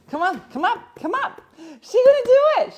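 excited coaxing. Real, not acted. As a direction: cheer the pet on in a squeaky high voice. Coaxing pet up the steps.

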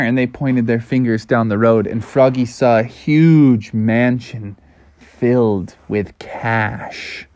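ordinary delivery

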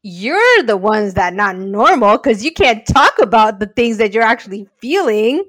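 loud, scoffing devil's advocate; defiant inner voice. Retold or imitated. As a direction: scoff and push back loudly. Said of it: "the devil's advocate and the weird person in me is like".

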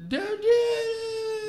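high, drawn-out hammed-up wail; mock desperate scream. Movie dad begging his daughter to stop, mock desperate scream.

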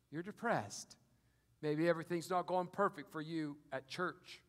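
ordinary talk

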